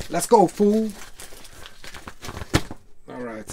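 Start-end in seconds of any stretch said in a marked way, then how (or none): 0.1-0.9 higher, louder tough-guy bark; hammed-up Mr. T impression, punching fool; mock A-Team tough guy calling out; B. A. Baracus. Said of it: "sounding like B A Baracus".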